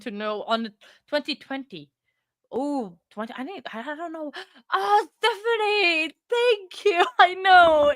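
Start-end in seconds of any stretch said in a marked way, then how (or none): 4.7-7.9 high, loud, drawn-out thanks with emotion; overjoyed. Real, not acted. Streamer thanking Stephanie for support, overjoyed. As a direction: squeal your thanks, then laugh.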